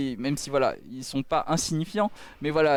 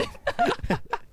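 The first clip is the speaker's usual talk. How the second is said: high laugh. Real, not acted. Caster laughs after replay.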